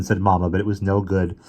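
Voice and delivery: ordinary delivery, nothing marked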